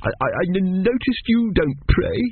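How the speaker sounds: put-on high, stammering child's voice; timid small boy. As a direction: venture it haltingly like a tearful little boy. Arthur, after crying, asks timidly.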